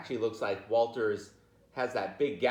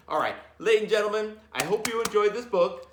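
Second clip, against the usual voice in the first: higher, louder and fast announcer flourish; host sign-off; 10 semitones higher, 7 dB louder than usual. Real, not acted. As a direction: close the show for the class. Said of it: "teacher signs off like host".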